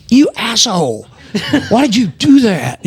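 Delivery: high-pitched, half-laughing mock outrage; mock indignant cast. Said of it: "And they were like".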